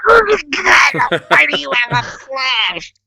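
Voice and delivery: alarmed whisper. Retold or imitated. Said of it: "I'm whispering this to him".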